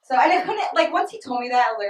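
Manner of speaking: ordinary talk, nothing marked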